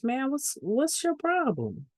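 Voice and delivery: high-pitched indignant challenge; imitated confrontation. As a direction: voice a husband demanding an explanation. Husband confronts man by phone.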